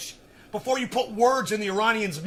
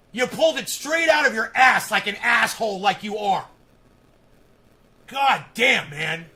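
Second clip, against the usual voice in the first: higher, louder furious tirade; angry yelling; 4 semitones higher, 7 dB louder than usual. Real, not acted. Berating a commenter on his live stream, furious shouting. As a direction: tear into him, shouting.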